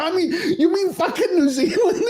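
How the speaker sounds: very high, laughing incredulity; laughing disbelief. Real, not acted. Co-host mocking the island hardship claim, laughing disbelief. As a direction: blurt it through laughter, pitch way up.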